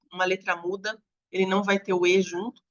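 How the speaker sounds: ordinary talk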